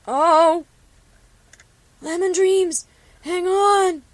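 high, loud, alarmed friend's voice; frantic call. Acted. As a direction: cry out in panic to hold on. Friend calls out at cliff edge.

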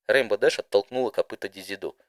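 ordinary delivery, nothing marked

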